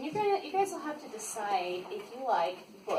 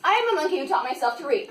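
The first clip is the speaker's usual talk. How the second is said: rushed, louder mock declaration; fast silly read; 5 dB louder, much faster than usual. Reader forced to claim he's a monkey, fast.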